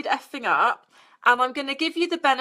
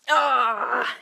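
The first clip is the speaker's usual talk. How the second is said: ordinary talk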